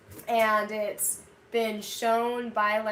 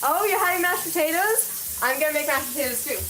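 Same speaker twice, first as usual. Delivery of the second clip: excited; 6 semitones higher, 7 dB louder than usual. Real, not acted. Excited reply to viewer comment.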